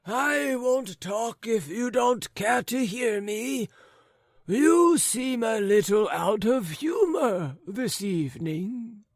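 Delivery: put-on high, wavering old man's voice; chatty old man. Forgetful old man telling his neighbours of a woman, high and doddering.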